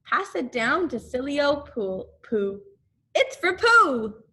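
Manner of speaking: high and loud, eager; excited. A narrator's reading. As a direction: call out eagerly. Christopher Robin excited.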